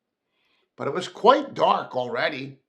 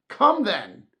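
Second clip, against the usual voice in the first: higher, louder cheerful comeback; gaily; 7 semitones higher, 6 dB louder than usual. Acted. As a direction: bounce back brightly. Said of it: "returned the nephew gaily".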